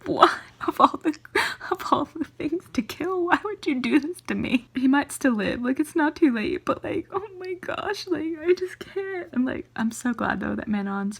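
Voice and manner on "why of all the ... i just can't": high, distressed protest; upset wail, voice pitched up; upset, pleading. Real, not acted. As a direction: plead in real anguish over a beloved character. Reader upset as beloved character dies.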